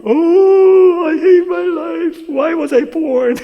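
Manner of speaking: high, loud, drawn-out mock wail; mock sobbing. Miserable teen praying alone in his room, mock sobbing. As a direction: bawl it out in hammed-up misery. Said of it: "pretty miserable, sobbing".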